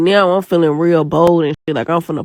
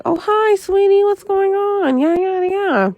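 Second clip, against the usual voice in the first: much higher, sugary phone greeting from mom; sweet mom voice; slower than usual. Retold or imitated. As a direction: imitate mom answering all sweet and innocent. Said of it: "my mama get on the phone like".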